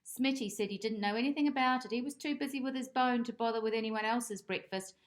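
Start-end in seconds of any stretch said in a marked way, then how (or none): none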